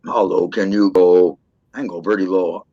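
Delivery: dropped low, deep and manly. Shown as a demonstration. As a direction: push the voice way down. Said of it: "Sound like a dude".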